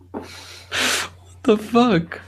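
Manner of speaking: drawn-out stunned high "what the fuck"; slow, high, baffled disbelief; incredulous. Real, not acted. Friend reacts to a baffling ad with stunned disbelief. Reacting to absurd time travel ad.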